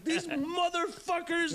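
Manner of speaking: high, slow, outraged yelp at the cops; mock rant. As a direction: drag it out, reliving the outrage up high. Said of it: "and I'm just going off".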